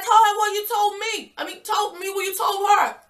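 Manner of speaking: high, loud shouted demand; retold shout. Narrator yelling at the cheater over the phone, high shout. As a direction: yell it at him, tripping over the words.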